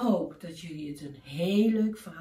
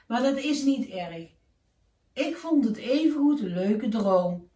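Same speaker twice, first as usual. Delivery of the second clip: cheerful goodbye; 3 semitones higher, 6 dB louder than usual. Acted. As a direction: wave everyone off happily. Keesje says goodbye to all.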